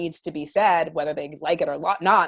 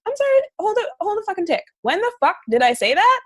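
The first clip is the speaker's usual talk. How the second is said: much higher, fast indignant outburst; outraged disbelief; 12 semitones higher, 4 dB louder than usual. Retold or imitated. Reacting to a friend's accusing message, high indignant outburst. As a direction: hit it with stunned outrage.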